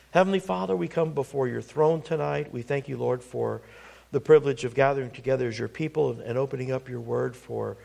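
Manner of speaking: soft, hushed closing prayer. Acted. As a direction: pray gently before the congregation.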